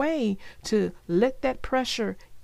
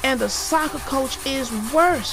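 mildly yelled cub's complaint; 3 semitones higher, 4 dB louder than usual. A narrator's reading. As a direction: push the voice a little, like a cub yelling. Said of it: "yelled Sister".

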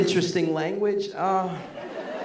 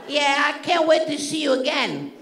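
higher old lady voice, heavily put on; old Sicilian woman; 4 semitones higher than usual. Old fan hopes to see the comedian again, old Sicilian woman voice. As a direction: play an adoring elderly Sicilian fan. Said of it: "an eighty-year-old Sicilian came up to me".

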